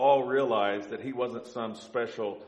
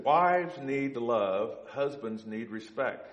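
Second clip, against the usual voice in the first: very slow, each word weighed; emphatic key line; much slower than usual. Acted. Preacher giving the congregation the core teaching, slow and weighty. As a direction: lay out the two halves deliberately.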